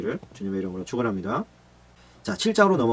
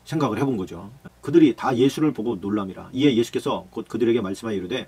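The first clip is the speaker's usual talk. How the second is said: ordinary talk, nothing marked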